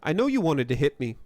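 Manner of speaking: ordinary delivery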